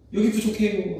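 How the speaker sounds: complaining call-out. Acted. Buffet guest telling staff there isn't enough, complaining call-out. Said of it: "소리치는 사람들".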